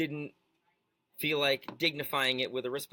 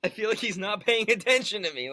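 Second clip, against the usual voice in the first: mock babyish whine, higher and louder; 6 semitones higher, 6 dB louder than usual. Co-host mocking the rapper's quote, babyish whine. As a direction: whine it like a sulking baby. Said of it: "he sounds like a baby".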